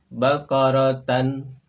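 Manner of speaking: "Bakaratan" slow and loud, ending tan; model Arabic reading. Shown as a demonstration. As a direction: stretch out "Bakaratan". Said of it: "samakan dengan contoh pelafalan kami". ("Bakaratan" pelan dan lantang)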